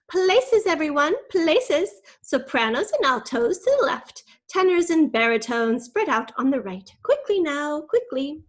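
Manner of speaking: high, loud call to places; brisk teacher calling out. Acted. Miss Dunbridge sends singers to their places, loud and brisk. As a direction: project like a teacher marshalling a choir. Choir teacher orders the room.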